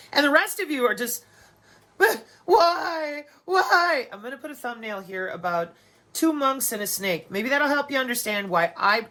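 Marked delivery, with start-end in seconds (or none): 2.0-4.1 high, whiny mock cry of why; mock whine. Mocking people who can't accept reality, in a high whiny cry. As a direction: whimper it like a pouting child. Mocks others whining.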